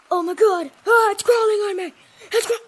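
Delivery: freaked out by a spider; screaming at a spider. Real, not acted. Something crawling on him.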